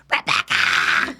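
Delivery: drawn-out mock yell, no real volume; retold yell. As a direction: imitate calling slowly down a hallway. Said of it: "I'm literally yelling down the hall".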